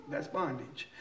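ordinary talk

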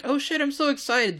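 excited dog voice. Retold or imitated. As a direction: squeal it like an eager pup. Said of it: "he was like".

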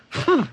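high doubtful hum; skeptical hmm. Retold or imitated. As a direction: give a high unimpressed hmm. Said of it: "the guy was just like".

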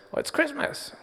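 very high, tiny bunny voice; little bunny voice. Acted. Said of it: "the little bunny says".

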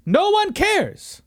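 very high, loud, stretched-out taunt; drawn-out mocking squeal; mocking singsong. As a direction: sing it out to needle the fans. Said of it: "That was for you".

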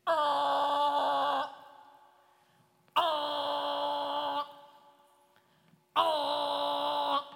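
long, high, held "oh" blast; loud horn-like "oh". Retold or imitated. Storyteller imitating a boat sounding as it comes across, long loud "oh".

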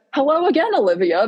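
bright eager greeting. Retold or imitated. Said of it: "that's insane".

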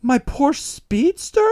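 very high, slow, drawn-out question; mock astonishment. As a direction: squeal it in hammed-up disbelief. Acting owner seeing car return.

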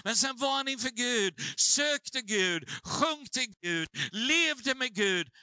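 fervent preaching. Acted. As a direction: build it up, preach it out.

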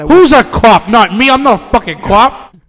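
loud, high, indignant mock challenge; mock outburst. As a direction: shout the others down in mock outrage. Comic outburst interrupting the others.

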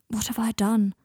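usual speaking voice

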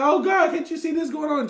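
loud, high panicked cry; panicked disciples. Retold or imitated. Disciples begging Jesus in the storm: loud, high panic. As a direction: cry out in mock alarm. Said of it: "They like".

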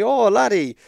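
high, loud Irish traveller voice imitated; Irish accent. Promoter talking to the young wrestler, in an imitated Irish accent. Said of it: "So he wasn't Irish".

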